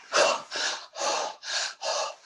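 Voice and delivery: slow, heaving breaths; heavy gasps. Retold or imitated. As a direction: gasp for air as if coming round. Demonstrates breath returning after collapse.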